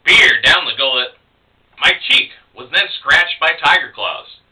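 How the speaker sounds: punchy poem recital with stressed openings; spoken-word reading in short bursts; poetry reading. Acted. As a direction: hit the key images, then ease off. Reads poem aloud.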